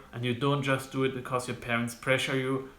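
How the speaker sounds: normal talking voice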